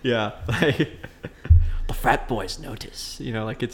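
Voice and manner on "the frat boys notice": slow Aussie wildlife narrator; Australian documentary voice. Shown as a demonstration. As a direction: draw it out in an Australian accent. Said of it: "the documentary voice when I do Australian".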